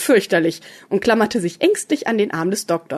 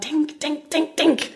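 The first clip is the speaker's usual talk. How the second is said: high-pitched repeated self-prodding; feverish thinking; 8 semitones higher, 4 dB quieter, slower than usual. A narrator's reading. Doctor racks his brain, hopping impatiently, feverish. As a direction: urge yourself on in a high voice. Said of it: "Er überlegte fieberhaft".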